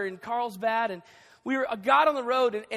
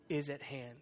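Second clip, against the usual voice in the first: ordinary talk, nothing marked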